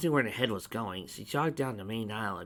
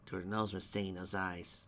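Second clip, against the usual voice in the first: plain reading voice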